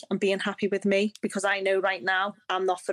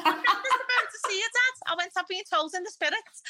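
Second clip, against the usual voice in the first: much higher protest to dad, through laughter; laughing self-imitation; 11 semitones higher than usual. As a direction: insist on it while cracking up. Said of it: "And I went".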